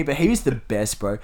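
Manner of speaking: ordinary talk, nothing marked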